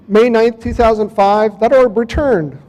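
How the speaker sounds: ordinary talk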